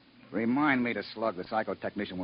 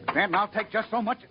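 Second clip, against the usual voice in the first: angry warning; 11 semitones higher, 3 dB louder than usual. Acted. Captain snaps at insolent engineer.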